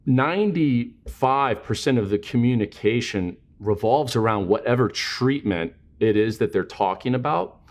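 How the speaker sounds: ordinary delivery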